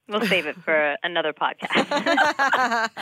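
slow, laughing through the end. Real, not acted. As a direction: chuckle as you put it off. Teasing, laughs mid-line.

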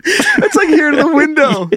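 giggling through the words; laughing at the short escape. Real, not acted. Mocking the sixty-foot run, laughing.

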